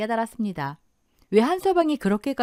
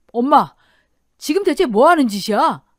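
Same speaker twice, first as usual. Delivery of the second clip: loud, outraged son's yell; shocked, angry shout; 3 semitones higher, 6 dB louder than usual. Acted. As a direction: yell at mother to stop. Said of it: "사이가 고함을 치며 달려오자".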